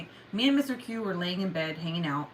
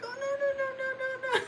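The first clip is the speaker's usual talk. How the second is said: very high wailing string of no's; mock breakdown; 4 dB quieter, faster than usual. Owner reliving the scare, hammed-up breakdown wail. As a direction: squeal it, overwrought, nearly laughing. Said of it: "had, like, a mini breakdown".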